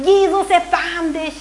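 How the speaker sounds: höher, lauter und langsam gerufen; flehender Ruf. Retold or imitated. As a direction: ruf Jesus verzweifelt hinterher. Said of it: "ihm hinterher schreit". (langsamer, lauter Hilferuf einer verzweifelten Mutter)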